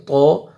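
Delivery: تو with thick, rounded emphatic t; heavy to. Shown as a demonstration. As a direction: make تو full and dark. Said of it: "موٹا کر کے پڑھیں گے". (موٹا کر کے تو)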